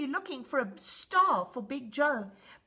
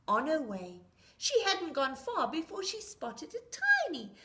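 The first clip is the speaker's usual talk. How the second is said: ordinary delivery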